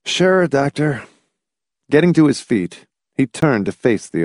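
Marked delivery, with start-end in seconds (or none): 0.0-1.0 slow, resigned agreement, pitched up; humoring him; sighing. A narrator's reading. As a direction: give in with a sigh. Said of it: "Hamilton sighed".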